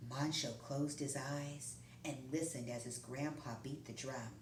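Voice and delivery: usual speaking voice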